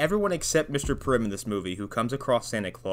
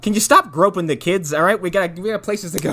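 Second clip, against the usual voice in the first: higher, louder nagging; hammed-up impatience; mock scold; 7 semitones higher, 5 dB louder than usual. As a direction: Sam tells Santa to stop hugging kids. Voicing Sam scolding Santa.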